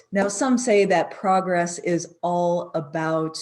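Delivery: ordinary talk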